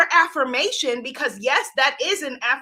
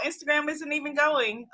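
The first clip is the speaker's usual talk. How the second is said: ordinary delivery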